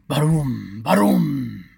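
loud, drawn-out vocal rumble; booming thunder imitation. Narrator voicing thunderclaps, loud swelling booms. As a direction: boom it out like thunder.

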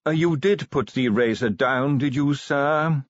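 nervous, tactful butler. Acted. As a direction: check delicately with the master, slowing at the end. Voices butler in story.

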